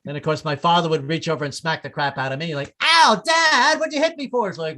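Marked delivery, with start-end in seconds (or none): 2.8-4.5 put-on high, loud indignant kid; a kid smacked at the table, high and loud; hurt kid. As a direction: yelp like a smacked child, pitched up.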